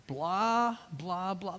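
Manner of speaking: lightly imitated droning lecturer; soft mock lecture babble; Charlie Brown teacher. Unheard lecturer to kids, lightly imitated drone. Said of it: "the Charlie Brown teacher's voice".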